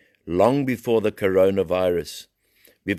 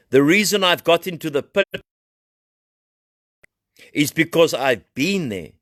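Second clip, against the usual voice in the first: high, loud, impassioned testimony; fiery preaching; 9 semitones higher, 4 dB louder than usual. Acted. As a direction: cry the story out to the congregation. Preaching voice, slow climax.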